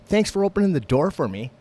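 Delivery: high, loud mock gratitude; pointed, accusing sarcasm; sarcastic thanks. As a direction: imitate an irate stranger's sarcastic thanks. Imitates woman's sarcastic complaint.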